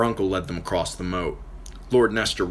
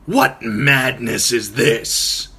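slow, louder blunt outburst; 4 semitones higher, 6 dB louder, much slower than usual. Acted. Uncle objecting to the night climb, blunt. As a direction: cut straight to it, no softening. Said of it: "he said bluntly".

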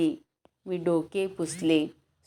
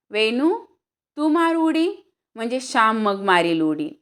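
loud, higher call to his sister; 4 semitones higher, 6 dB louder than usual. Acted. Brother calling his sister to jump first, loud call. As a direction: shout across to get her jumping.

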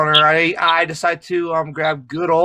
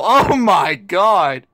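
drawn-out words through laughter; helpless laughter; 5 semitones higher, 4 dB louder, much slower than usual. Real, not acted. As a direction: gasp it out slowly while cracking up. Reeling from fart in mic.

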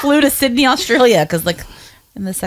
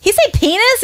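high child's voice asking "He say penis?", mock yell; imitating her yelling kid; 12 semitones higher than usual. Mother retelling her son asking about the word in the theater, high mock child yell. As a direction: play a four-year-old blurting it out in the cinema. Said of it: "And he's, like, yelling".

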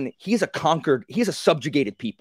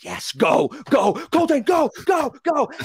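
high, loud cheering go go go; yelling encouragement from the edge of the seat; a fan cheering on Coltane; 13 semitones higher, 4 dB louder than usual. Retold or imitated.

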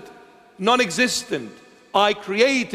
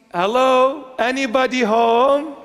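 higher sing-out, as if knocking; mock calling out; 4 semitones higher, 3 dB louder than usual. Preacher tries to wake up the club-goer, mock calling out. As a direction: call out to someone not listening.

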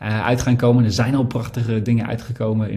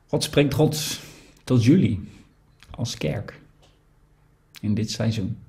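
guided reflection. Acted. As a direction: invite the congregation to listen inwardly. Leads a quiet reflection. (stille overdenking, zacht en traag)

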